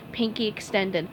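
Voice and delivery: usual speaking voice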